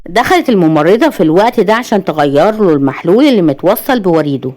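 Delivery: ordinary delivery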